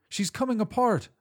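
high panicked cry; screaming. Acted. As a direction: cry out in terror. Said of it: "I screamed".

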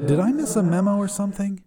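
high, quick, unthreatening voice; delivery boy. A narrator's reading. Deflated demon asks about a memo, delivery-boy voice. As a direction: drop the demon act, sound like a delivery boy. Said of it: "changing from demon to delivery boy".

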